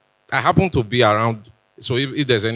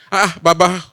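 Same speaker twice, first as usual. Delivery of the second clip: higher-pitched mock wondering; lightly acted, higher questioning voice; imagined puzzled caller; 6 semitones higher, 3 dB louder than usual. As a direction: ask it like someone baffled by the excitement. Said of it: "they would have been wondering".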